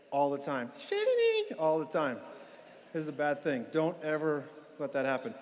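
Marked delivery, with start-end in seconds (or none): 0.8-1.6 very high squeaky granny voice; grandma voice. Retold or imitated. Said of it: "walks around with that voice".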